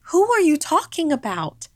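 high, loud demanding question; a mother urgently questioning her screaming child; alarmed mother. A narrator's reading. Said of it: "my mother asked".

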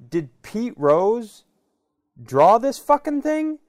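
can't believe the price; half-shouted mock outrage; incredulous. Incredulous retort to seller.

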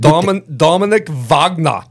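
slow, hammed-up German accent. Shown as a demonstration. Host competing for best accent, German accent. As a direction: draw the name out in mock German. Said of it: "who has the best German accent".

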